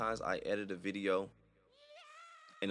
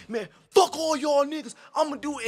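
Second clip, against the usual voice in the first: possessed spazzing out; 10 dB louder than usual. Pretend. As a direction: snap into a crazed possessed rant. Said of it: "I'm spazzing out".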